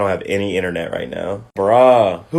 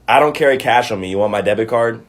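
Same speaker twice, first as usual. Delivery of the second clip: normal talking voice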